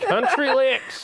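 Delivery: laughing out the words, high and slow; amused repeat through a laugh. Real, not acted. Echoing the viewer's request, laughing.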